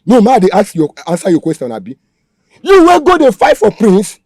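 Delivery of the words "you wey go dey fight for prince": angry scolding. Acted. Kidnapper scolding the captive.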